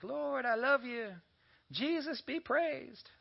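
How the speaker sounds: high, slow, gushing mock praise; sing-song churchy praise voice, hammed up; mock worshipper. Preacher mimicking shallow Sunday worshippers, gushing. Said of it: "we're like".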